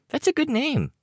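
put-on very high-pitched child character voice; Looksee. A narrator's reading. Looksee approving of the name Chicken Little, very high-pitched. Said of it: "Looksee said".

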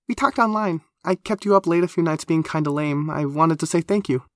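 high, excitable character voice, grateful; keen, high-voiced admirer. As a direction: gush thanks in a high-pitched voice.

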